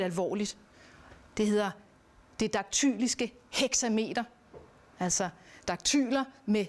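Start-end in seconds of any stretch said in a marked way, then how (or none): none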